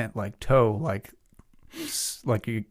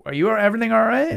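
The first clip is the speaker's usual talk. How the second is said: higher, louder questioning voice; concerned bystander; 11 semitones higher, 5 dB louder than usual. Retold or imitated. Bystander to someone lying under a truck, concerned. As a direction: call out a concerned check. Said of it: "somebody being like".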